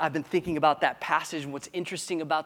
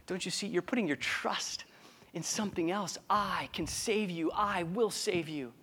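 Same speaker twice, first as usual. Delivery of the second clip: much softer, calm reassurance; reassuring Jesus; 2 semitones higher, 6 dB quieter than usual. Retold or imitated. Jesus reassures the scared disciples, soft and calm. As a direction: soothe frightened friends. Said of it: "Jesus is like".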